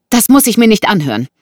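high, loud protest; indignant woman. Acted.

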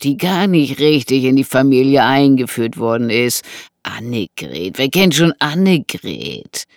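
loud, theatrical mockery; sneering intruder. Intruder recalls her embarrassing wedding, sneering. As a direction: relish her humiliation, leaning on words.

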